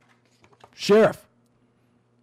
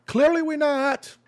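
high-pitched retort; 7 semitones higher than usual. Real, not acted. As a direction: voice jumps up high in protest. Rebutting a quoted accusation.

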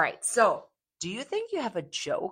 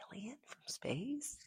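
whispering; 9 semitones higher, 17 dB quieter, slower than usual. Real, not acted. Host whispers aside.

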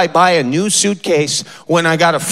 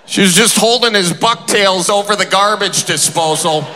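ordinary talk, nothing marked

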